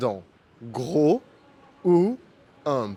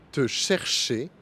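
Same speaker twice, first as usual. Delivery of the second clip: ordinary delivery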